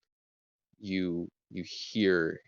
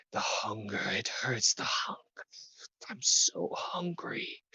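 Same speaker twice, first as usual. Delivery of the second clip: ghoul whisper; 3 semitones higher, 3 dB quieter than usual. Acted. Skeletal gnolls clinging to the bard, whispering their hunger. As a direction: hiss it out like a starving corpse. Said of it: "whispering at you as you continue playing".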